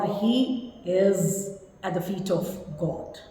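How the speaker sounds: soft, slow, gentle phrasing; hushed, tactful delivery; euphemism. Shown as a demonstration. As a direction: break it gently, slowly and quietly. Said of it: "put it in a right way".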